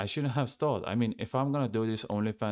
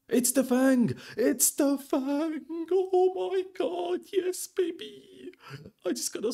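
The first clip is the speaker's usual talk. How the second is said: ecstatic scream; slower than usual. Real, not acted. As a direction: shriek it out slowly, overjoyed. Rare drop reveal, screaming.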